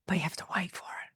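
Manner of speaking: hushed, breathy-free whisper for effect; whispered aside. Acted. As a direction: lean in and whisper it like a secret. Stage-whisper aside to listener.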